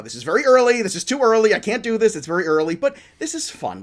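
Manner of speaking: a hint of Tags panicking over early rankings; mock anxious Tags. Host teasing his cohost Tags: a mock-anxious whine. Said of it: "you've mentioned it once, maybe twice before the show to me".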